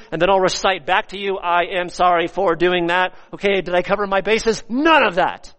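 high, loud, insistent sermon voice; full-force preaching. Real, not acted. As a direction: pour scorn on the cold apology from the pulpit. Preaches against mechanical apology.

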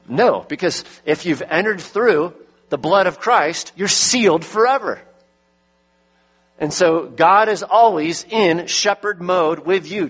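rising pulpit fervour; preaching at full force. Acted. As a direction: press the promise on them. Preaching rises to climax.

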